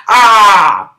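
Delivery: a sharp, loud yell; sudden scream. Retold or imitated. Tarek screaming at Saru to loosen him up, loud scream. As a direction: let out a short scream. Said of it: "Scream back at me".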